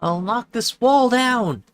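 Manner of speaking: angry neighbor lady. Acted. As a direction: bellow the threat through the wall.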